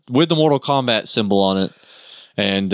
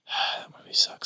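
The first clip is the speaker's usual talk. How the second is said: sighing whisper; 11 dB quieter than usual. Real, not acted. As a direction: breathe it as an aside. Whispered aside.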